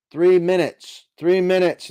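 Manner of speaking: loud announcement, repeated; calling out. Real, not acted. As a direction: call out the time left. Announcing countdown loudly.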